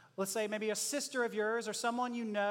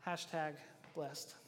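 deadpan; 8 semitones lower, 11 dB quieter, slower than usual. Real, not acted. Announcing last sermon point.

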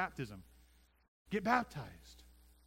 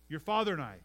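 "father" pushed louder; stress on father; 6 dB louder than usual. Shown as a demonstration. Pastor stressing Mary meant Joseph, "father" hit. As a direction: lean hard on "father". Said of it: "right? Joe and me".

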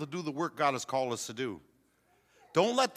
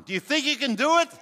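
louder, higher and faster, pushing for an answer; rousing call to the crowd; 10 semitones higher, 8 dB louder, faster than usual. Real, not acted. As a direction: fire up the congregation. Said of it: "Come on".

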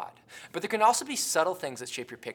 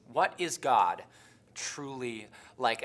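"truly like" drawn out slowly; slow, weighty "truly like"; stress on truly; 4 semitones lower, slower than usual. Shown as a demonstration. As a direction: lean hard on "truly" and stretch it. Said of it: "I say and emphasize the word truly".

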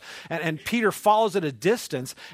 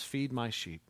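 gentle command; 5 semitones lower, 8 dB quieter, slower than usual. Retold or imitated. Jesus speaking gently.

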